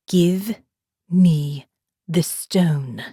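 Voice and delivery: slow, firm, deliberate threat. A narrator's reading. Morgan to the pinned biker, slow firm threat. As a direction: drag each word out, steady and menacing. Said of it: "speaking slowly but firmly".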